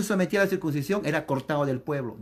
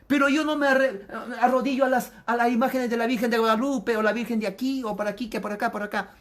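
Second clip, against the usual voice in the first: mock evangelical; 6 semitones higher than usual. Preacher mimicking an evangelical boasting he doesn't kneel. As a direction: parody a self-righteous evangelical.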